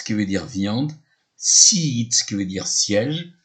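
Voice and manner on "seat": seat, long ee, louder. Shown as a demonstration. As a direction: stretch the ee in seat. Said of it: "E A se prononce I". (seat avec un i long, appuyé)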